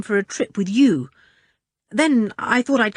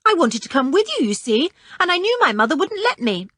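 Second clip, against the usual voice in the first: put-on higher, cocky boyish boasting; bravado; 9 semitones higher than usual. A narrator's reading. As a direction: brag a little, pleased with himself. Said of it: "with a great air of bravado".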